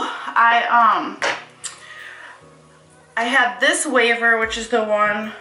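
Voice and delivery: talking while winded; out of breath. Real, not acted. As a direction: still catching her breath. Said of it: "I'm, like, out of breath".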